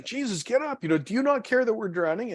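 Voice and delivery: high-pitched mock panic; acting out an urgent, high wake-up cry; disciples' alarmed plea. Disciples waking Jesus in the storm.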